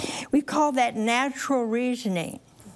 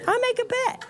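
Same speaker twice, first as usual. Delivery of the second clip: high bet; 13 semitones higher than usual. Shown as a demonstration. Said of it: "said twice, first much higher".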